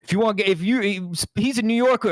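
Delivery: host hams up the movie line for Chloe; mock tough New Yorker. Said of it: "reenact this powerful moment".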